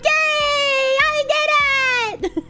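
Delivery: high, slow, laughing yay; laughing mock cheer; mock triumph. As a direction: drag out a giddy squeal of victory. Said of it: "And I was like".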